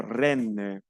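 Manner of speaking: renne with a long, open "eh"; elongated open E. Shown as a demonstration. Teacher models the longer E to learners, drawn-out "renne". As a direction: stretch the vowel of renne slowly. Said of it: "es un poco más alargada".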